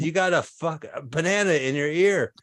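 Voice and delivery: mock-frustrated attempt to get through; exasperated repeat. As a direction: push it up in pitch, losing patience. Third try, man still not hearing.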